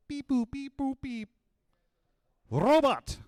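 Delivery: high beeping toy robot voice; robot sounds. Pretend. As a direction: beep like a little toy robot. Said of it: "Those were robot sounds".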